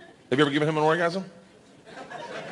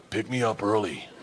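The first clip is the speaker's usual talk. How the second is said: hushed, low conspiratorial ask; whispered; 7 semitones lower, 6 dB quieter than usual. Retold or imitated. Daughter whispers to dad at school drop-off, hushed. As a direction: lean in and whisper it like a secret. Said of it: "whispers in my ear and goes".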